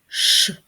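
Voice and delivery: Ш as a loud lone hush; strong Ш with no vowel after it; isolated sound. Shown as a demonstration. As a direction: sound out Ш by itself. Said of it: "Третій звук".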